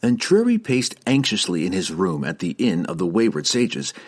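usual speaking voice

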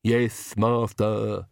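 lisping servant voice; slow, lisped ridiculous servant voice; Igor. A narrator's reading. Servant answers the Count, in a ridiculous lisping voice. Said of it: "And the ridiculous voice, too".

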